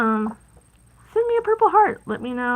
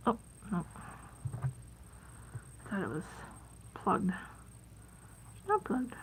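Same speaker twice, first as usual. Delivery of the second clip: muttering; 2 semitones lower, 15 dB quieter, much slower than usual. Real, not acted. Glue bottle fails, mutters to self.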